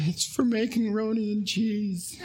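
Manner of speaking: imitating his daughter answering earnestly; little girl voice. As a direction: play the guileless kid who misses the threat. Child's innocent answer in story.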